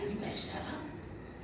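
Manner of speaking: hushed, unvoiced warning; whispered warning. Friend in a store whispering a playful warning. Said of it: "She's like".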